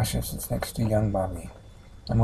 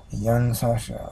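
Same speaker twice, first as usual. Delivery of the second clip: usual speaking voice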